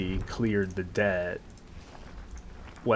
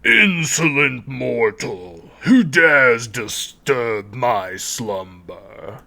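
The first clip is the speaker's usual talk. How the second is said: slow, booming spirit voice; ancient rasping undead king; 3 semitones higher, 8 dB louder, much slower than usual. Acted. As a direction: loom over the intruder, slow and loud. Spirit wakes, threatens intruder.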